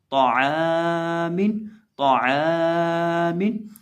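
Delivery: ताआमिन with आइन stretched one alif, very slow; lengthened recitation. Shown as a demonstration. Teacher demonstrates stretching आइन for students, slow chant. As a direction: hold the आ in ताआमिन long. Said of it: "तो आइन को लंबा करेंगे".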